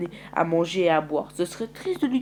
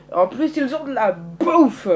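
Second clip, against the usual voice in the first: proud announcement; 7 semitones higher, 7 dB louder, slower than usual. Acted. Boasting the newcomers' food.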